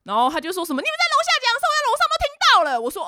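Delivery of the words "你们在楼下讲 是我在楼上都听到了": very high screechy accusation, speeding up; mock very angry. Regina complains she overheard from upstairs, mock angry shriek. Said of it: "还是非常的生气".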